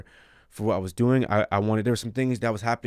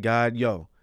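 ordinary talk

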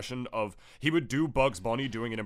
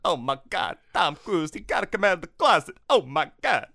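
put-on voice, higher and louder; hammed-up gossip voice; mock celebrity voice; 7 semitones higher, 6 dB louder than usual. Joking about Tom Cruise to his co-host, in a hammed-up put-on voice.

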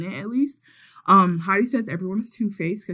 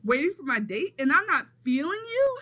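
high-pitched indignant retelling; incredulous mock outrage; Sweetie going off; 9 semitones higher than usual. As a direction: imitate someone affronted, voice pitched up. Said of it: "sis is going off".

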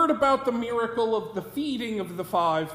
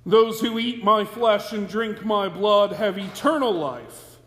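louder, measured reading of the scripture; solemn scripture reading; 2 semitones lower, 4 dB louder than usual. Acted. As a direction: proclaim the text to the congregation. Reads the Gospel passage aloud.

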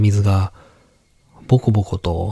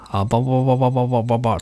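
underwater babbling; 3 semitones higher, much faster than usual. Acted. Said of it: "水に潜って声を出しているような".

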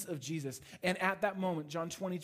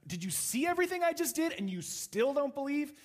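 higher-pitched incredulous rebuke, lightly played; mock scolding; 9 semitones higher, 3 dB louder than usual. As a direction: scold him in disbelief. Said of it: "Just scolded him and shamed him".

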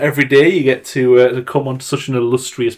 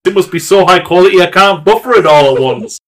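louder, higher comic rant; raised-voice mock complaint; retold exasperated listener; 5 semitones higher, 4 dB louder than usual. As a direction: act out a listener griping in exasperation. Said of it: "then it was like".

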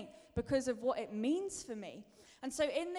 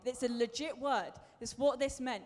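ordinary talk, nothing marked

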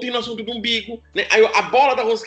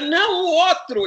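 mother correcting; 8 semitones higher, slower than usual. Retold or imitated. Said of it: "A mulher".

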